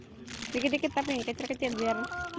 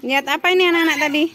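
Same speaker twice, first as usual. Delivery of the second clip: calling out; 5 semitones higher, 10 dB louder, much faster than usual. Real, not acted. Parent asking children what they saw, calling out. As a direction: call over to the little one, louder and higher.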